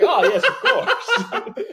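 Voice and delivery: very high squeaky laugh-talk; laughing falsetto. Retold or imitated. Laughing mock reply.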